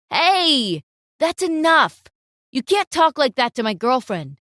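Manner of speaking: loud, firm warning; angry boyfriend telling him off; protective boyfriend. Acted. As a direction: stand up for her, hard. Boyfriend defends girlfriend.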